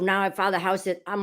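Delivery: ordinary delivery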